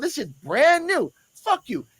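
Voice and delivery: high, slow, indignant snap; angry retort. Retold or imitated. Cop telling off the smelly man, high indignant snap. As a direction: throw it back at him, offended. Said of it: "he said out loud".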